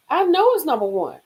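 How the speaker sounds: usual speaking voice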